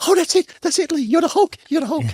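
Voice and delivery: hammed-up producer cheering him on as the Hulk. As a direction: squeal it like a thrilled producer finally getting the take.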